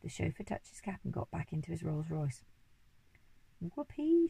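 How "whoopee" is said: high, loud whoopee; monkey shouting for joy; joyful shout. A narrator's reading. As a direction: whoop with delight. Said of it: "shouted the monkey".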